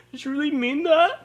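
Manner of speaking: high-pitched mock professor; offended professor. Student mimicking his professor's challenge, mock offended.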